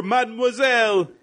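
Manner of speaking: high, lilting French greeting; French accent. Retold or imitated. Podcaster repeating the officer's door greeting, French accent. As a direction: call out politely with a French accent. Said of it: "he switches back to French".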